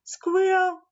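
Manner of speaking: usual speaking voice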